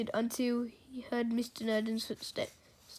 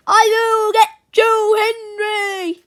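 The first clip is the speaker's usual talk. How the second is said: loud, high furious scream; enraged teacher's full-voiced threat; 10 semitones higher, 14 dB louder than usual. A narrator's reading. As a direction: scream it vengefully. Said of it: "he screamed".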